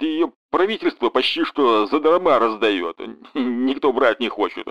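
lightly voiced Max. Max brushing off the fox on the phone, lightly voiced. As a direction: Max playing it offhand.

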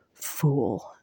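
breathy, contemptuous whisper; whispered scorn. Acted. Tamlin scorning the girl, in a whisper. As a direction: hiss it low and cold.

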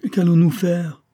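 high, loud helpless question; exclaimed. A narrator's reading. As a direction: cry it out in dismay. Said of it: "s'écria Céline".

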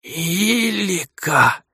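slow hoarse croak of a name. Acted. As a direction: rasp it out weakly. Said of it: "прохрипел я". (хриплый слабый голос)

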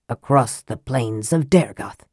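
lightly voiced dwarf prince. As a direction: speak as the proud dwarf prince. Prince figure voiced low.

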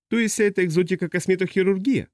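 high-pitched, disbelieving question; incredulous customs officer. Acted. Customs officer queries exotic looks, high and incredulous.

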